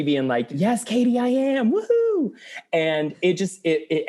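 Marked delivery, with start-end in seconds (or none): 0.5-2.3 high, exultant fan whoop; elated cheering. Retold or imitated. Fan answering [name]'s song, elated cheering. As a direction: climb up into a gleeful woo.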